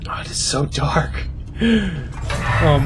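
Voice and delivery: scared whisper in the dark; hushed, nervous whisper. Real, not acted. Streamer whispering in dark game.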